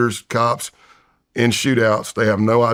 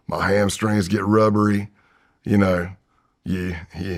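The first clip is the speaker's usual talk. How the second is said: normal talking voice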